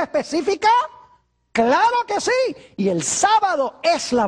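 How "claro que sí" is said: much higher, slow emphatic cry; preacher's ringing yes; emphatic exclamation. Real, not acted. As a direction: throw it out as the obvious answer. Emphatic answer to rhetorical question.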